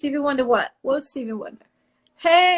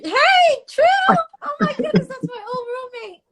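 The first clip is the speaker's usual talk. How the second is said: excited recognition; 10 semitones higher, 4 dB louder than usual. Real, not acted. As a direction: squeal a hello to a friend. Spots her old roommate in chat.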